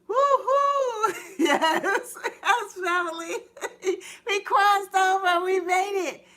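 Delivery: high, loud whooping with laughter; excited celebration. Real, not acted. Host celebrating the new year with her viewers, high and loud. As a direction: celebrate making it.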